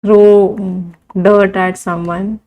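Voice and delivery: very slow, word by word for writing; slow classroom dictation; dictation pace. Shown as a demonstration. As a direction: spell it out slowly so they can write.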